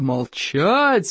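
drawn-out mock roar of a chief; roaring command. A narrator's reading. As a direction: roar for silence, without real volume. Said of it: "взревел Стоик". (рёв вождя)

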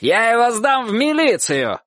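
high, indignant threatening cry; shouted threat. A narrator's reading. Dog-catcher threatening police for the boy, shouting. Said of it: "кричал Собаколов".

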